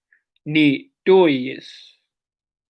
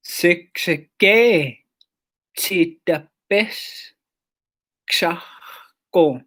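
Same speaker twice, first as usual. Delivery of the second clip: Siksikaitsitapiskasahko stretched syllable by syllable; a very slow teaching demo; very slow drawn out; much slower than usual. Shown as a demonstration. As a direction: draw out Siksikaitsitapiskasahko very slowly.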